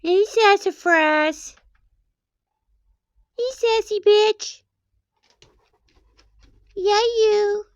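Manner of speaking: high mock-sweet greeting; sing-song baby talk; high teasing pet voice. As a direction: tease the dog sweetly.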